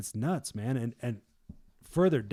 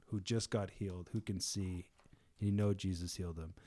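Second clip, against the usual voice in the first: ordinary talk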